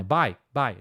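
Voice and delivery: "Buy, buy" mais grave, com ditongo "ai"; pronúncia correta. Shown as a demonstration. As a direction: repete "Buy, buy" devagar como modelo. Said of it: "É fácil de falar, né?". ("Buy" pronunciado certo, "bai")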